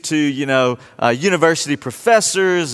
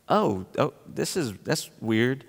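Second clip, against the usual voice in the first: soft, confused double take; softer, quietly baffled; puzzled; 3 semitones lower, 9 dB quieter than usual. Retold or imitated. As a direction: viewer baffled by the final statue. Spotting Mickey Mouse statue.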